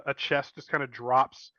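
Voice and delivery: normal talking voice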